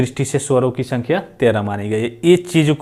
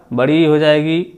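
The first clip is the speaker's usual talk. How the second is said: ordinary delivery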